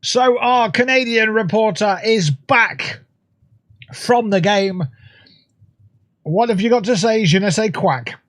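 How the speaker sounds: lightly done Canadian accent, mock sports anchor; a hint of a Canadian newsreader handing over; mild mock Canadian TV presenter. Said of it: "best Canadian accent I could do".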